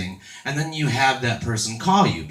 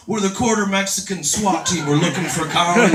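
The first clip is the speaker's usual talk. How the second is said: raised, higher-pitched raid announcement; mock SWAT shout; 7 semitones higher, 4 dB louder than usual. SWAT team kicks in a dad's door, mock shouted announcement. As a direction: call it out like a hammed-up police raid.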